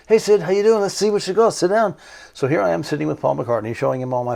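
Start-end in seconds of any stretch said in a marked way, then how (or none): 0.1-1.9 higher, louder breezy greeting. Retold or imitated. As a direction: toss it off light and friendly like a star putting you at ease.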